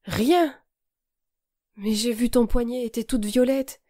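the words catch in his throat; strangled voice. A narrator's reading. Guilty boy protesting about her bruised wrist, choked. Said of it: "dit-il d'une voix étranglée".

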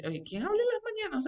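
high-pitched, soft, quick mock questioning; quick little put-on high voice; acted waking-up question. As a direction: act out asking in a small, high voice. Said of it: "Ahí me levanto y digo".

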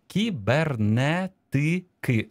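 slow, each syllable set apart; syllable-by-syllable dictation. Shown as a demonstration. Teacher checking a dictation for listeners, syllable by syllable. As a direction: spell it out by syllables for writers.

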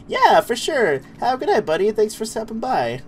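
high cartoon goodbye; Goofy voice. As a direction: say goodnight like Goofy. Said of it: "I gotta talk like Goofy".

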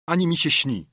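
usual speaking voice

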